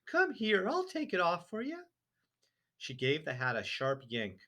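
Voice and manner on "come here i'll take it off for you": high, prim wifely voice; a fussy wife brushing him off; wife's voice. A narrator's reading. Wife dismissing husband's hat trouble, high and prim. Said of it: "his wife said".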